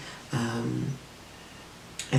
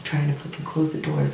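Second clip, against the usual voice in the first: ordinary talk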